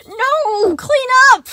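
very high, loud shrieking order; frantic cry. A narrator's reading. Sun crying out over scattered blocks. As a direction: cry out in panic. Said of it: "Sun cried".

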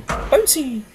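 high, loud squeal; high-pitched bouncy cheer; gleeful exclamation. Real, not acted. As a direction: squeal it with playful delight. Gleeful cry about bouncing ball.

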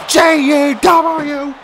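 high, very slow chanted letters; drawn-out call. Real, not acted. Commentator calling out initials mid-match, drawn out. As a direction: stretch each letter out high.

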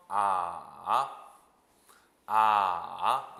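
"아" dipping low, then rising; third tone. Shown as a demonstration. Teacher modelling the third tone, low dip then rise. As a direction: scoop "아" down and swing it back up. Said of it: "삼 성".

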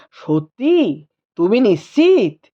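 low, stunned whisper of joy; can't believe the news; astonished joy. Acted. Husband learning wife is pregnant, amazed. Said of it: "রীতিমতো চমকে ওঠেন".

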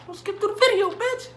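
high, loud squeaky yell at the viewer; mock scolding yell. Yelling at viewers who skip the video, high and loud. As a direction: shriek at them for skipping.

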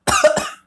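high loud choking cough; sharp cough. Real, not acted. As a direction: cough hard from the spice. Cough after hot chip.

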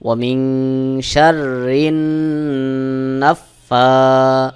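very slow chanted recitation with ghunnah; slow Quran recitation. Shown as a demonstration. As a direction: draw out each word in tajweed. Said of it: "অবশ্যই গুন্নাহ করতে হবে". (ধীরে তাজবীদসহ তিলাওয়াত)

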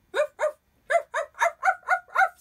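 loud yappy dog barks. Acted. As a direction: bark like an excited dog. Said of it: "said Dog".

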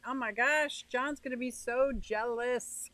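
ordinary talk, nothing marked

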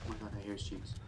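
normal talking voice

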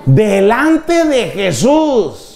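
high, very drawn-out wonder; acted amazement. Child seeing dad kneel before Jesus, amazed. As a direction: stretch it out in hammed-up awe.

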